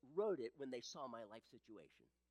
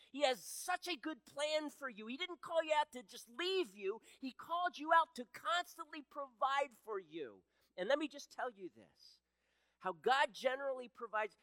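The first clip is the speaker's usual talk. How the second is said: higher and louder, pressing the good news home; full-force preaching; 9 semitones higher, 8 dB louder than usual. Acted. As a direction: preach with rising passion to the church.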